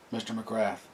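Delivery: drowsy, soft and trailing; half asleep, mumbling the name; sleepy voice. A narrator's reading. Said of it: "said a sleepy voice".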